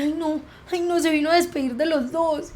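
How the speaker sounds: high, shaken voice of mom's friend; spooked friend. Retold or imitated. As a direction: pitch up as if startled by a ghost. Mom's friend hears ghost voice. (el amigo de la mamá, asustado y agudo tras oír la voz)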